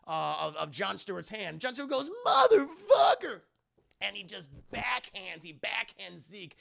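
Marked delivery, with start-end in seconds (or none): 2.2-3.4 high, loud, stretched-out curse; enraged shout. Retold or imitated. Villain losing his fingers, shouted curse. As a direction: yell the curse slowly at full voice.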